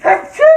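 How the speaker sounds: loud sudden sneeze; sharp high achoo. Real, not acted. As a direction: let a real sneeze burst out. Sneezes again while cooking.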